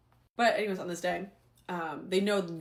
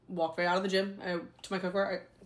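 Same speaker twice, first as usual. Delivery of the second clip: ordinary talk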